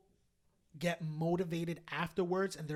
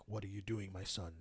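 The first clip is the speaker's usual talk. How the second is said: divine voice; 9 semitones lower, 8 dB quieter than usual. Retold or imitated. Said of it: "he just kept saying".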